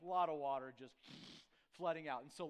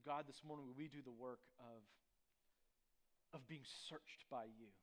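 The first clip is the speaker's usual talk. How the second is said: soft, earnest prayer voice, mostly quieter; pastoral prayer; 3 semitones lower, 6 dB quieter than usual. Acted. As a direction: pray over the congregation, sincere and hushed. Pastor leads congregation in prayer.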